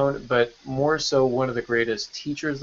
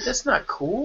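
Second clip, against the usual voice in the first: mock scolding; 10 semitones higher, 5 dB quieter, slower than usual.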